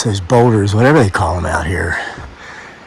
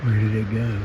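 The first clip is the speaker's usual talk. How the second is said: hushed; 17 dB quieter than usual. Real, not acted. Hushed while spotting something.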